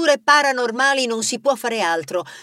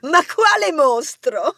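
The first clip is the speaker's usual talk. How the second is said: higher, laughing disbelief; bursting out laughing; 6 semitones higher, slower than usual. Acted. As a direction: laugh it off, amused. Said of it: "Rebella scoppiò a ridere".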